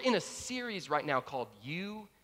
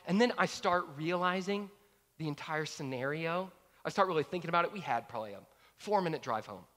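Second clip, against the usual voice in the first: ordinary talk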